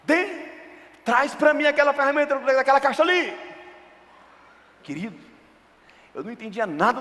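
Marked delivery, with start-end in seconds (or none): 0.0-3.5 high, loud calling out an order; grandpa's order. Retold or imitated. Grandfather calling the boy to fetch a tool, loud high command. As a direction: shout the errand across the yard. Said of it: "E ele falava".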